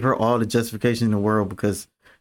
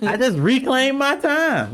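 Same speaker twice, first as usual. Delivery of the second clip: 1 sassy drawn out; 12 semitones higher than usual. Real, not acted. Joking boast to friend.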